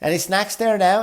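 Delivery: chirpy attendant. Retold or imitated. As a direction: sing it out like a cheery flight attendant. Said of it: "she goes".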